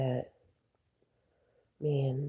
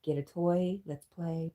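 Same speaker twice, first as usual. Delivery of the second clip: usual speaking voice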